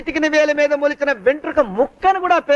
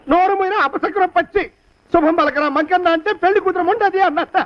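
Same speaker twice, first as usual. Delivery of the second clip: angry master's scold, pitched high; scolding higher; 5 semitones higher than usual. Acted. Master scolding servant about missing corpse, angry and high. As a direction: snap at the servant, voice up.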